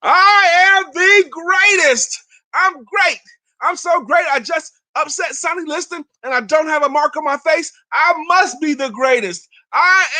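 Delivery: high, loud ecstatic scream of victory; screaming triumph. Retold or imitated. Young boxer screaming into the microphone after an upset win. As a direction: shout it like a champ who just won. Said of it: "he started screaming into the microphone".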